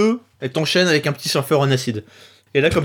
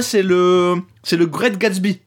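ordinary talk, nothing marked

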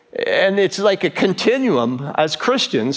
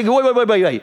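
rapid-fire, higher-pitched repeated waits; urgent interruption; 3 semitones higher than usual. Acted. Centurion halting his servant as he leaves, urgent interruption. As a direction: cut in quickly to stop someone.

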